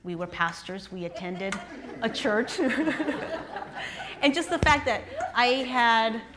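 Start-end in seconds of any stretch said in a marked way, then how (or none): 2.7-4.2 a real, high rising laugh. Real, not acted. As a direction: laugh at the irony. Laughing about being pastors.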